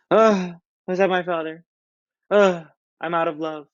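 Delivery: high, slow, groaning lovesick whine; dramatic mopey Romeo. Retold or imitated. Teacher hamming up Romeo's moping for the class, high and slow. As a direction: drape yourself over a couch and moan it. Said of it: "Picture him, like, draped down".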